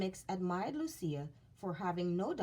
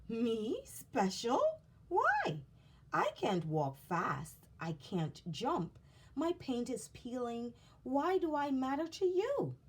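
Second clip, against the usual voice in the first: disbelieving puppet; 6 semitones higher, 3 dB louder than usual. Acted. Punchinello in disbelief.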